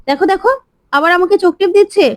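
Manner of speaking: high, urgent tattling; outraged pointing out. Acted. Ahona urges everyone to see Ganga winking, high outraged tattling. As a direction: cry out to make them look, pitch up.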